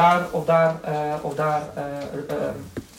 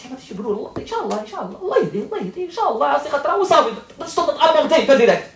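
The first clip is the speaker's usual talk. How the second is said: higher-pitched, lightly voiced eager mother; mock eager mother; 8 semitones higher than usual. Imitating the eager mother of a prospective bride, higher and lightly voiced. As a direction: play a hopeful mother hedging with inshallah.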